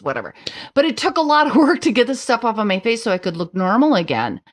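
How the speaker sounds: ordinary delivery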